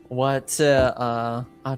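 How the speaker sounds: ordinary talk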